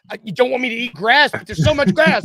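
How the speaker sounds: high, loud protesting dog; complaining dogs. Acted. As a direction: plead like an overwhelmed pet. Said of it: "My dogs go out there and they're like".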